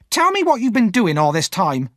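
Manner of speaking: high, loud demanding scold; angry scolding. Acted. Sister demanding where Pip was, angry scold. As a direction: demand an answer, furious.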